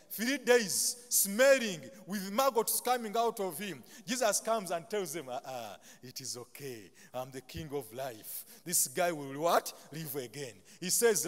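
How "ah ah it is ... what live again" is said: softer, lower soothing voice; calm reassurance. Acted. Jesus quietly reassuring the mourners at the tomb. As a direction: settle the grieving family gently.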